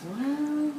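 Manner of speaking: drawn-out, rising well; hesitant reply. Acted. Joke, guy's drawn-out reply.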